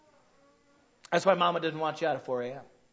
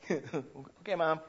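put-on meek kid reply; 3 semitones lower, 6 dB quieter, much slower than usual.

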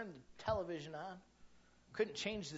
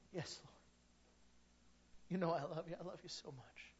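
softer, moved confession; a hint of a man near tears; emotional; 8 dB quieter than usual. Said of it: "Peter getting more and more emotional".

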